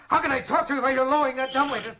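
loud, high-pitched frantic complaint; agitated shout; exasperated outburst. Acted. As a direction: snap at him, voice climbing in panic. Exasperated at janitor, panicking.

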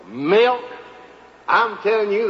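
ordinary talk

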